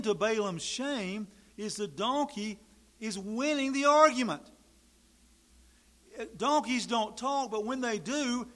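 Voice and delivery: loud, fervent pulpit preaching, rising high; preaching at full force. Acted. Preacher to his congregation, loud and fervent. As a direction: build the sermon to a fiery peak.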